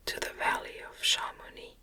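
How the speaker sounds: ordinary delivery, nothing marked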